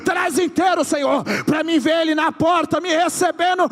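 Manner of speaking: rising to loud, high prophetic cries; prophesying. Acted. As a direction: build the prophecy to a shout. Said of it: "eu tô profetizando". (profecia crescendo até o grito)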